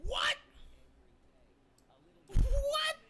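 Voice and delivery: high, loud startled yelp; startled what. Real, not acted. Streamer startled by the mic, yelping. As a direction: jump at it, yelp the word out. Startled outburst at the pop filter.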